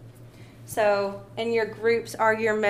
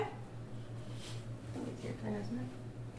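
whisper; 17 dB quieter, much slower than usual. Real, not acted. Nudging child to take turn.

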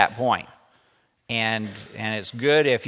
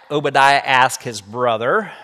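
lively read-aloud storytelling voice; story narration; 4 semitones higher, 8 dB louder than usual. A narrator's reading. Teacher reading a story to the class, lively read-aloud. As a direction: bring the story alive for the students.

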